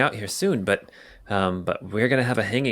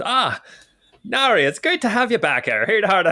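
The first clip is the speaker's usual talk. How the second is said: hammed-up Irish accent, much higher; Irish barman accent; 10 semitones higher than usual. Barman greeting Nari, in a hammed-up high Irish accent. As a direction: play the barman as a stage Irishman. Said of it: "no Irish accent".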